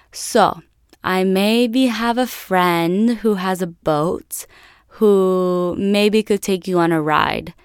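put-on slow, hesitant Italian-accented English; tentative offer in a put-on Italian accent; drawn-out mock Italian accent; imitated Italian father. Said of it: "this big Italian guy".

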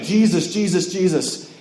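lightly voiced panicked plea "Jesus"; mildly mock panicked grandmother. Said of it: "she's starting to freak out".

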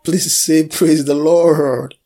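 slow, drawn-out rejoicing call; exultant praise. Real, not acted. Preacher prompts congregation to praise after the reading, exultant. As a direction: stretch the praise out loudly.

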